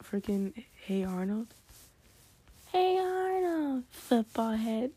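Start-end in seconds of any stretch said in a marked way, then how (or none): 2.7-4.9 very high, drawn-out call, then dropping; cartoon catchphrase call. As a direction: stretch the name out high, then taunt slowly.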